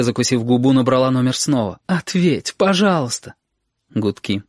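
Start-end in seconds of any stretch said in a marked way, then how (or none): 1.9-3.3 отчаянная мольба. Acted. Сашка в панике звонит.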